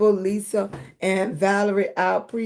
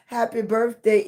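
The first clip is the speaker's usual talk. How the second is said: usual speaking voice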